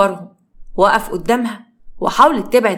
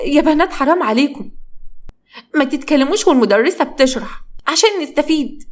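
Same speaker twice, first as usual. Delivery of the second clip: higher-pitched scolding of noisy classmates; scolding girls; 6 semitones higher than usual. Acted. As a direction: call out to the girls, pleading. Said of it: "سهى زعقت لهم". (صوت أعلى بتزعق للبنات)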